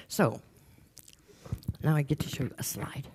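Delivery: a soft whispered aside to the audience. Real, not acted. Speaker teasing the audience about showing a slide, whispered. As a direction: let them in on a secret. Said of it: "whispered aside before slide".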